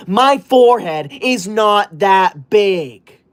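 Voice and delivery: slow indignant denial. Acted. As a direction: spell it out, offended.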